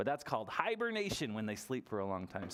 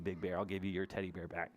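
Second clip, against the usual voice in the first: ordinary talk, nothing marked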